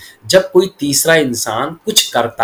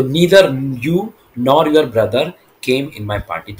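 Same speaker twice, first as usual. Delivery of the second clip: slow word-by-word model; much slower than usual. Shown as a demonstration. Teacher models sentence word by word.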